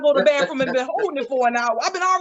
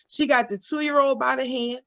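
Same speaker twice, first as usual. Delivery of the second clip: usual speaking voice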